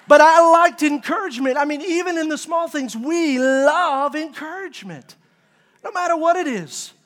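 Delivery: high, loud, emphatic sermon delivery; forceful preaching. Acted. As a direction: drive the point home to the congregation.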